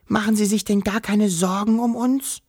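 high, small, let-down voice; plaintive high child-like question; disappointed whispering. A narrator's reading. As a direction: ask it high and hurt. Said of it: "flüsterte Wischel enttäuscht".

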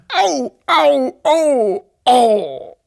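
high, loud wails of pain; pained groaning. A narrator's reading. Injured Wawuschel father moaning over his foot, loud pained groans. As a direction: draw out each "ow", sinking at the end. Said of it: "stöhnte der Wawuschelvater".

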